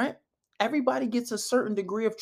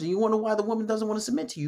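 ordinary talk

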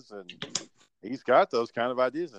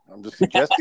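raised, louder, defensive retort; mildly hostile; 7 semitones higher, 10 dB louder than usual. Real, not acted. Podcaster defending his suggestion to a reluctant co-host, mildly hostile. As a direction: push back louder and higher, a touch hostile. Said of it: "why we gotta get hostile right out the gate".

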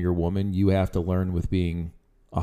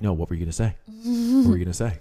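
imitating wife; 3 semitones higher, faster than usual. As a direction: needle him like a wife who wants the truth. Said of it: "then she'll be like".

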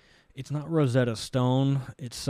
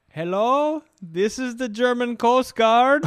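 高くゆっくりしたドイツ訛りの英語; 落ち着いた沿岸警備隊員をドイツ訛りで; German accent; 11 semitones higher, 6 dB louder, slower than usual. Retold or imitated. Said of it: "But the guy's German".

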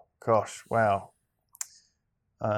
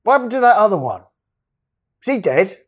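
imitating a fan; 8 semitones higher than usual.

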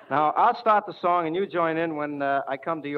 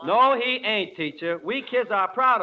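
brash schoolboy protesting, pitched up; kid voice; 5 semitones higher, 5 dB louder than usual. Acted. Schoolboy sassing his teacher, pitched-up kid voice. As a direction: talk back like a cheeky pupil.

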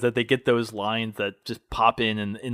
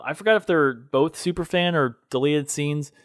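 ordinary talk

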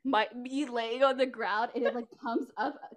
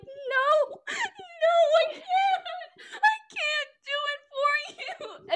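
very high, louder, slow hammed-up crying; mock sobbing refusal; 7 dB louder, slower than usual. Friend voicing Beth crying and refusing in the pitched scene. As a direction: wail it like a weepy heroine. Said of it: "Beth is like".

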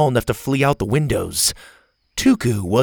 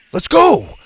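high, loud let's go; urgent loud call; shouted; 13 semitones higher, 8 dB louder than usual. A narrator's reading. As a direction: yell it back across the bus. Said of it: "he shouted back at Bo".

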